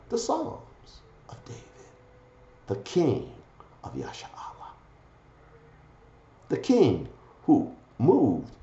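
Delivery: slow, hushed recital with long pauses; solemn preaching. Real, not acted. Solemn recital about King David.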